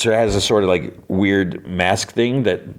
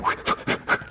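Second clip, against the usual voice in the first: quick voiceless puffs of air; blowing on burn; 8 dB quieter than usual. Retold or imitated. Cake soothing a burned hand, imitated blowing. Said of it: "like, blowing on a burned hand".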